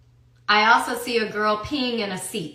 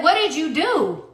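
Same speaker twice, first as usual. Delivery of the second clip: scared mom yelling, higher and louder; retold yell from a frightened mother; yelling at son; 6 semitones higher, 5 dB louder than usual. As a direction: snap the question at him in fear, louder. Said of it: "I started yelling at him".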